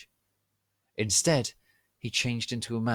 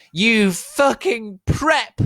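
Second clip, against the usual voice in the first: very high, loud, slow insult; yelled; 12 dB louder, much slower than usual. Acted. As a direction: scream the insult at Snape. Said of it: "yelled Draco".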